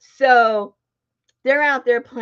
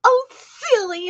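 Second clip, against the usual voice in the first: put-on coy little girl; 5 dB louder, slower than usual. Little girl teasing the husband, coyly high. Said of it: "And she goes".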